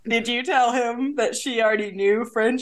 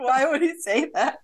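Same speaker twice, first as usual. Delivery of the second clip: higher-pitched, laughing question; laughing, baffled; 5 semitones higher than usual. Real, not acted. Co-host retelling confusion about the teacher, laughing. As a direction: ask in amused bafflement through a laugh.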